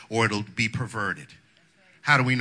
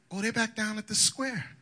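mock gossip reply; 10 semitones higher than usual. Gossip answer voiced high.

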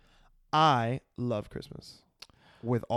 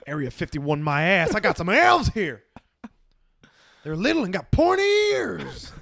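wild rambling cackles; 6 semitones higher, 8 dB louder than usual. Acted. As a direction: ramble like a crazed backwoods man. Acting excited redneck.

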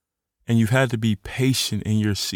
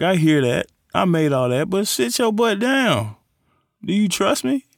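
God's voice; 7 semitones higher than usual. Retold or imitated. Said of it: "And God, like".

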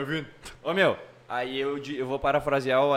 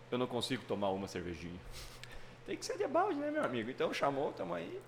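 much softer imitation, like a private confession; Adriano Imperador; 14 dB quieter than usual. Host paraphrasing a footballer's drinking line, hushed imitation. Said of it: "Parafraseando, Adriano Imperador".

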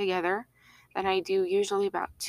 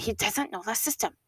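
lightly voiced fussy sitcom character; a hint of mock exasperation, in character; Monica Geller; 3 semitones higher than usual. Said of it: "Saying in my Monica Geller voice".

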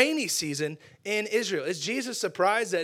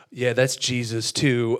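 deadpan reply; 6 semitones lower, 8 dB louder, slower than usual. Acted. Second disciple glum answer.

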